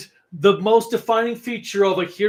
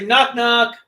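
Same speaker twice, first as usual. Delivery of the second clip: calling knock knock; 5 semitones higher, 5 dB louder than usual. Retold or imitated. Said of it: "I open the door and say".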